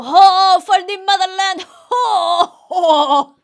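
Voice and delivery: loud, high mock-patriotic cry; hammed-up ecstatic shouting; excited praise. Shown as a demonstration. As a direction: whoop it out for the motherland in a Russian accent. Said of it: "excitedly praise. You go".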